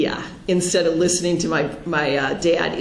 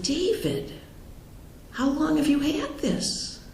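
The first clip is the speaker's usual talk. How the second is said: higher and softer, stunned disbelief; shocked plea; 9 semitones higher, 7 dB quieter than usual. Retold or imitated. Sister asking her brother about his diagnosis, high and hushed in shock. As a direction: ask it as if the ground just dropped away.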